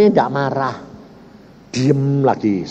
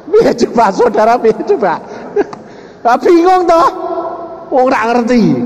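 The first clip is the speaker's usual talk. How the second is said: amused question; 10 semitones higher than usual. Real, not acted. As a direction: laugh along with the listeners. Preacher asking the congregation, amused. (bertanya sambil tertawa geli)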